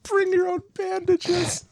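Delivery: spoken through real laughter, high and slow; can barely get the words out for laughing; cracking up at the joke. Real, not acted.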